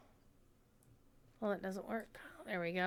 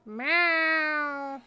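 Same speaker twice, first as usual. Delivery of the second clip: cat meow; 5 semitones higher, 6 dB louder than usual. Pretend. Meowing for cat character.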